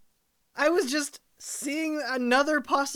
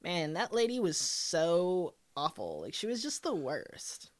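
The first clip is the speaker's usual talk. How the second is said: put-on lower, bored gossiping girl; low valley girl voice; 8 semitones lower than usual. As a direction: dish about her, bored. Girls gossiping about neighbor.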